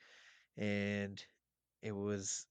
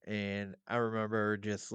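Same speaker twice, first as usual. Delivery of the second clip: ordinary talk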